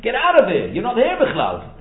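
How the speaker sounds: loud, high-pitched order to leave; acted indignant dismissal. Speaker throwing Esav out on Achas Hayom, acted indignant dismissal.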